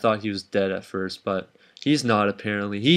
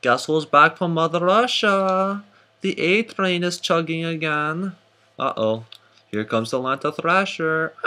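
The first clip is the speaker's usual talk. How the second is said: bad Russian accent; 6 semitones higher than usual. Host announcing who's back from Mother Russia, bad Russian accent. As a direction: put on a hammed-up Russian accent. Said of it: "Why do I have a very sad accent?".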